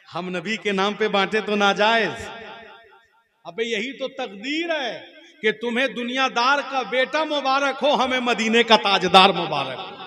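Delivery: loud rising sermon climax; fervent preaching. Real, not acted. Preacher rousing the crowd, loud and fervent. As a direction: proclaim it to the whole crowd.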